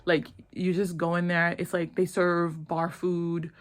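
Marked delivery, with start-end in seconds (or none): none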